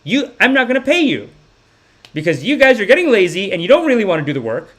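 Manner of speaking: high, indignant mother telling off workers; scolding mom impression. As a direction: mimic a mom's scolding, pitch climbing. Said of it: "she calls some of these aunties and she's like".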